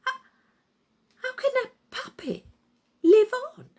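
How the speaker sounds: high, fast, loud stammer; terrified disbelief. Acted. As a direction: ask it shaking with fear. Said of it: "trembling with terror".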